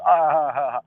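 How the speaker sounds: spelled-out, put-on mocking laugh; imitated mocking laugh. The guys on the panel laughing someone down, put-on mocking laugh. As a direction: cackle like the guys laughing at him. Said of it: "They gonna laugh".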